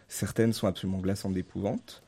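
hiccuping through the line; words broken by a hiccup. Real, not acted. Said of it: "je hoquette".

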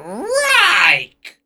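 high, drawn-out umpire holler of strike; umpire's strike call. Retold or imitated. Old umpire calling a strike at the plate, hammed-up holler. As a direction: bellow the call like a ham umpire. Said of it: "And he's like".

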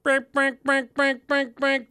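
high, light horn beeps; honking voice. Retold or imitated. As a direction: beep like a friendly car horn. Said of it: "The heads up, like".

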